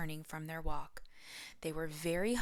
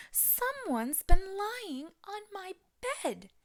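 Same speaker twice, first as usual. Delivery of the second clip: high, slow, loud indignant sputter; Papa Bear sputtering; 6 dB louder, slower than usual. A narrator's reading. Papa Bear finds his bed lain in, sputtering loudly. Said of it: "Papa Bear sputtered".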